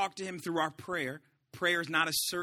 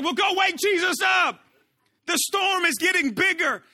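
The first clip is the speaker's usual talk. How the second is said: high, loud panicked shouting; freaking out; 12 semitones higher, 9 dB louder than usual. Acted. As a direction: shout it like a disciple panicking in a storm. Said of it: "they were freaking out".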